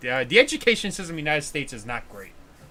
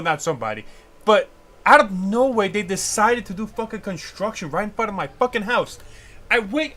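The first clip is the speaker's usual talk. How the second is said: ordinary delivery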